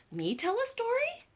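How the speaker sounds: high surprised squeak. Acted. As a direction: ask it amazed. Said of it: "said the little red chicken".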